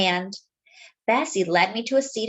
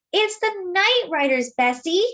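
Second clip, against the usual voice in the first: high, breathless urgent warning; panicked warning to Bessie, much higher; gasped warning; 8 semitones higher than usual. A narrator's reading. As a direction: gasp it out, out of breath from running. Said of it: "I managed to gasp".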